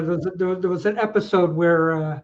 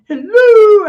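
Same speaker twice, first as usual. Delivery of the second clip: mock hello; 12 semitones higher, 13 dB louder than usual. Mimicking the hello.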